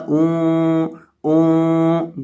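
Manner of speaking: اون held long through the nose; very slow nasal اون; nasal ghunnah. Shown as a demonstration. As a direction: hum the nun in اون nasally. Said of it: "گنہ کر کے پڑھیں گے".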